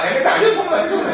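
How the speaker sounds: high loud scoff with a laugh; mock parent scolding. Parent scolding a child who fakes pain, mock scoff. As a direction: tease the faker, half laughing.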